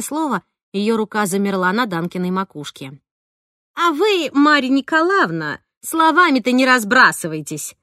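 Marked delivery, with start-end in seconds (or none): 3.7-7.8 sharp, louder, higher nanny rebuke; nanny sharply warning the tutor; sharp retort. A narrator's reading. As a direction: snap back at the tutor. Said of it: "резко заявила она".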